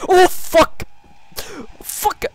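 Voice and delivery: sudden high, very loud yelp; startled scream. Real, not acted. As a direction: scream out in real fright. Sudden scare in horror game.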